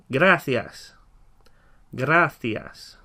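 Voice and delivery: "gracias" drawn out slowly with a lisped th; teacher modelling slowly; slow model. Shown as a demonstration. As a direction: stretch "gracias" so learners copy. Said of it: "follow that as we say the words in Spanish".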